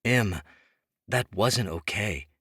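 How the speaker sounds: ordinary delivery, nothing marked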